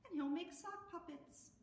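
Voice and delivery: ordinary delivery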